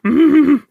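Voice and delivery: high, loud closed-mouth whine; creature squeal. Acted. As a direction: squeal through closed lips like a small creature. Shaken elf makes creature noise.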